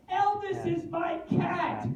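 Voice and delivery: put-on high, shrill old woman's retort; indignant old lady. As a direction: snap back as the offended granny. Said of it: "she looked at me and she said".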